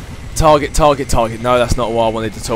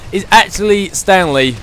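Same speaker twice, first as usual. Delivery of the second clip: normal talking voice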